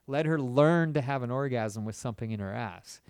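ordinary talk

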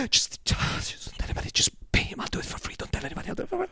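hushed, urgent whisper; imitating Nacho whispering. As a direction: whisper it like a secret deal.

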